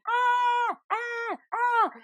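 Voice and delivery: high imitated squeal of a giddy toddler; playful mock shriek; happy toddler scream. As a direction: mimic the child shrieking for fun. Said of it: "She was literally screaming, but in a happy way".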